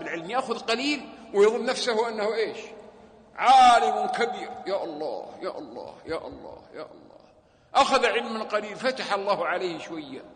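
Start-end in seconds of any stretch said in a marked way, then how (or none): 3.4-4.5 mock grand scholar. Mocking the conceited student. 4.7-7.2 sorrowful sigh. Real, not acted. Lamenting sigh repeated.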